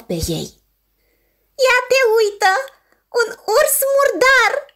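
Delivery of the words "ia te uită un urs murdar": high, loud mocking seal cry; seal shouting. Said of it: "strigă o focă".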